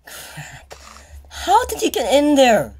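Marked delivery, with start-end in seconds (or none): 1.5-2.7 frightened disbelief at a jump scare; rattled and shrill with shock; scared. Real, not acted. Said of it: "never been so scared in my life".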